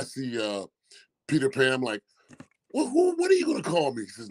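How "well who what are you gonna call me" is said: incredulous. Retold or imitated. Confused question to friend.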